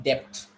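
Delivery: "debt" as dept, middle consonant sounded; common mispronounced debt. Shown as a demonstration. Teacher quoting many people's mistake, debt as dept. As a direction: put a P sound before the T in "debt". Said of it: "many people call it debt".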